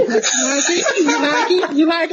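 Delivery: high laughing falsetto; high girly voice. Retold or imitated. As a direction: squeal it up high, teasing. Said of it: "And he be like".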